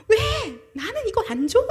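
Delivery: put-on high, loud childish complaint; mock whiny crying. Preacher mimics believers leaving worship, mock tearful whining. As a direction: whine like a child denied a gift. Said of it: "라고 하고 울면서".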